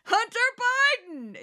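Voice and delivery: hammed-up Fox viewer shouting a name; Fox fanatic imitation. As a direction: spit out the name like a gotcha. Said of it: "that's my imitation of a Fox fanatic".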